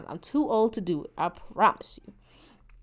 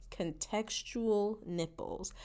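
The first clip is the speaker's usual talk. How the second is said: quiet slow deadpan; 3 semitones lower, 12 dB quieter, much slower than usual. Real, not acted. Deadpan final punchline.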